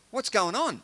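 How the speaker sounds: mock bewildered call; puzzled blind beggar. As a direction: call out a puzzled question over crowd noise. Voicing blind Bartimaeus asking the crowd.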